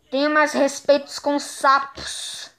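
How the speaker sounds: ordinary delivery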